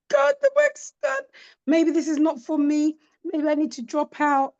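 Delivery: high, overwrought wail of giving up; mock-tragic cry that it's all over; dramatic despair. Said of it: "and I was dramatic".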